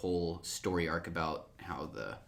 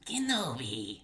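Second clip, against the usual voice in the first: a hint of a drawn-out sneering Kenobi; Ventress impression; 5 semitones higher, slower than usual. Friend doing Ventress greeting her enemy, lightly sneering. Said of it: "That was pretty good".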